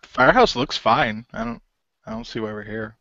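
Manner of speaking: ordinary talk, nothing marked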